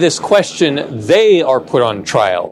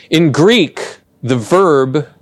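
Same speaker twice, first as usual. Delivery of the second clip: usual speaking voice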